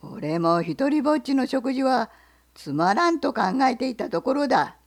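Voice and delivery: bear. Acted. Bear asks the fox what he sells, low and loud. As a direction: growl the question like a bear.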